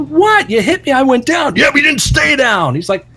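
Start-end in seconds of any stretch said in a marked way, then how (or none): 0.0-1.6 high, protesting mock argument; indignant retort. Manager answers the wrestler yelling at him, indignant retort. Cornette's reply in retold row. 1.6-2.7 high, imitated angry comeback; mock yelling. Garvin keeps berating the manager, mock yelling. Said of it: "Garvin is yelling at Cornette".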